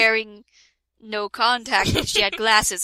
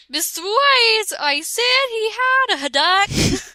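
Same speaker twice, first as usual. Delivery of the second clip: high mocking voice; 11 semitones higher, 5 dB louder than usual. Reading fanfic author's note.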